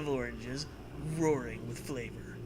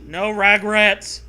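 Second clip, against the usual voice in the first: mock solemn vow; 6 semitones higher, 12 dB louder, slower than usual. Echoing the vow louder.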